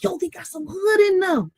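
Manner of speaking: high excited call; high-pitched hyped exclamation; amazed shout. Retold or imitated. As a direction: holler it at a friend.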